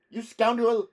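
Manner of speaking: put-on high, loud burst of outrage with an Italian accent; loud, high-pitched Italian indignation; outraged Italian cry. A narrator's reading. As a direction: cry out like an affronted young Italian. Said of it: "cried in a noticeably Italian accent".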